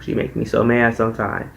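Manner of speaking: usual speaking voice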